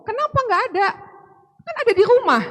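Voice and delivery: high-pitched, disbelieving demand for an answer; acting out an exasperated youth leader, voice shooting up; exasperated questioning. (bertanya kesal dengan nada tinggi)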